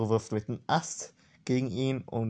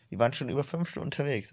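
usual speaking voice

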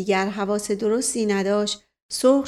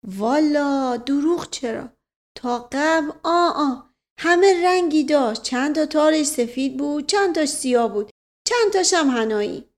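rambling servant, earnest; 5 semitones higher than usual. Acted. Servant reporting on his bald townsman, earnest and raised in pitch. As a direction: play an earnest storytelling manservant. Voices servant Mash Qasem.

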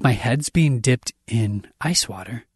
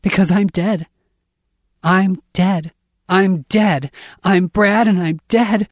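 high, raised, horrified voice; frantic, pitched up and loud; screaming panic; 7 semitones higher, 5 dB louder than usual. A narrator's reading. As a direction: cry it out in mounting panic. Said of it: "I'm screaming".

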